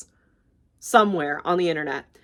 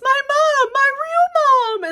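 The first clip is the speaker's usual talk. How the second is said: very high, loud, drawn-out squeal; hammed-up shriek at finding a long-lost mother; mock overjoyed cry; 6 dB louder, slower than usual. Jack Pumpkinhead sees his real mom, mock overjoyed squeal.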